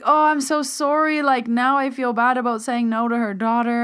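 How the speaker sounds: apologetic. Retold or imitated. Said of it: "So I was like".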